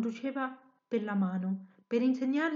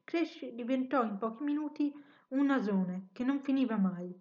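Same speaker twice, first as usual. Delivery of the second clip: ordinary delivery, nothing marked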